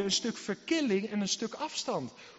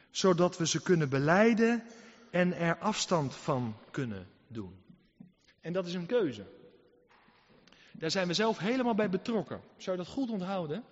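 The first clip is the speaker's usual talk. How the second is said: usual speaking voice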